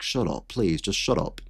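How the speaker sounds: a weary quiet groan of shut up; mock hushed plea. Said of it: "I'm like".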